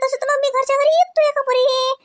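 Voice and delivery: high, mock-sassy retort; wife retelling her cheeky reply to her husband. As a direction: toss the husband's idea back at him. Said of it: "म्या म्हणलं".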